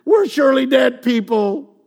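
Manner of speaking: high, loud hammed-up whine; mock despair. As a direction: overdo the doom. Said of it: "and they're like".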